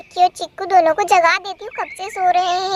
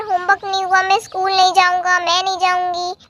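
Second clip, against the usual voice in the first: ordinary talk, nothing marked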